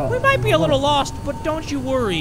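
high, quick cocky captain voice. Acted. Captain reassuring the crew, high and breezy. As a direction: brush off the danger as a breezy captain.

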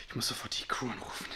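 whispering. Acted. Aside after a shock.

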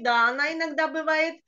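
ordinary talk

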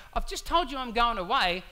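rising exasperation at the disciples who won't ask; frustrated Jesus. Retold or imitated. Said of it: "I can sense the frustration in him going".

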